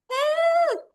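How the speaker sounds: high, drawn-out storybook call for help; cry for help. Acted. Dragonfly in a puddle calls to be rescued, high cry for help.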